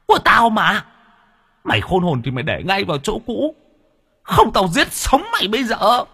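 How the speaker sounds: very high, menacing spirit threatening the thief; shrill ghost voice. Acted. Trapped ghost threatening the young master, shrill and high. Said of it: "nó cất tiếng the thé".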